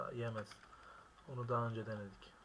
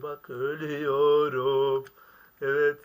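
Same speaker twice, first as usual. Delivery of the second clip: rising panic shout; 3 semitones higher, 16 dB louder, much slower than usual. Real, not acted. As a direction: build from hush to a desperate yell. Bike about to fall.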